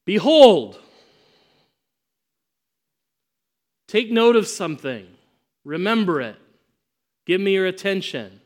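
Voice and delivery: loud, high Behold, then firm commands; commanding call to attention. Acted. Preacher voicing Jesus, loudly calling the disciples to attention. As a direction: call out to grab their attention.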